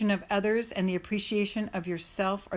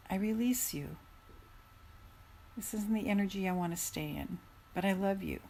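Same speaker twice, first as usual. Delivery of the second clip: tender affirmation; 7 dB quieter than usual. Shown as a demonstration. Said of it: "demonstrates release affirmations".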